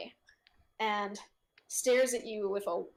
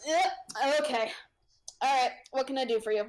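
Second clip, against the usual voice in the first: a lightly voiced sighing merchant, a bit higher; weary vendor; 4 semitones higher, 6 dB louder than usual. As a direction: perk up from a sigh into a half-hearted sales pitch. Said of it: "heaves this giant sigh".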